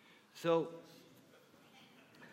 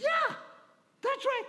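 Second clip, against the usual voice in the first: high, eager insisting; eager advisors. Acted. Advisors eagerly assuring the king, high and bright. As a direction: jump in with excitement.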